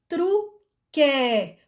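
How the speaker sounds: "truque" drawn out very slowly with a clear TR; teacher sounding "truque" out slowly; slow demo. Shown as a demonstration. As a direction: stretch "truque" so the silent U shows. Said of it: "Que vem sempre do lado do U".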